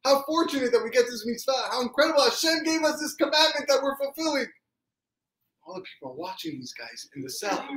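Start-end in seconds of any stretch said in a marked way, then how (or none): 0.0-4.5 high, rapturous exclaiming; jubilant gratitude. Acted. Dancing brothers thanking Hashem for the mitzvah, jubilant wonder. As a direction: marvel aloud at your good fortune. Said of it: "this is what they're doing".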